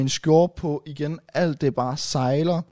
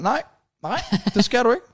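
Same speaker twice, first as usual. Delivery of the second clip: retold shouting; 6 semitones higher than usual. Drunk on the toilet floor answering door-bangers, retold shouting. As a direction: call out a helpless, high refusal. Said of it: "Og jeg råber bare".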